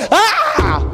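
high, laughing cry of frustration; exasperated yelp. Retold or imitated. Pastor imitates a parent snapping at a child, laughing yelp. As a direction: let out a fed-up yelp, half laughing. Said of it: "You're gonna go".

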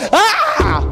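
high-pitched exasperated "Ah!"; mock scream of frustration; upset scream. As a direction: act out a parent snapping with a high cry. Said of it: "You're gonna get upset".

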